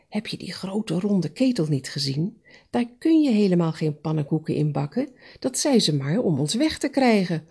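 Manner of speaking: plain reading voice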